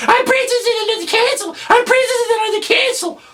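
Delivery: rapid, very high falsetto parroting; mocking little kid. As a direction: mimic the kid's squeak, fast and exasperated. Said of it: "there's this little kid in the cage".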